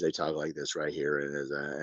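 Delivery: low, quiet monotone mimicking podcast hosts; unchanging monotone. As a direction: drop low and soft, imitating their unchanging tone. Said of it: "Their tone of voice doesn't change".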